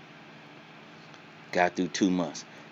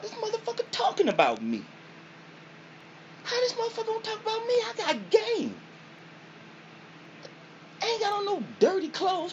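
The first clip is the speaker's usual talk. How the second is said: very high squealing outrage; outraged high-pitched retort; indignant protest. Retold or imitated. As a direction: squeal back, offended and defensive.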